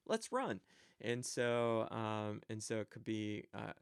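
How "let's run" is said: sudden high urgent call; mock alarm at a dangerous animal. Shown as a demonstration. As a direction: shout it as if danger is near. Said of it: "there's a dangerous animal over here".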